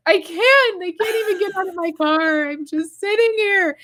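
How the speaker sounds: mock sob on the phone; imitated weeping. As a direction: replay yourself crying to mom. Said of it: "And I'm, like, literally weeping".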